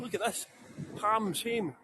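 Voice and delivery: ordinary talk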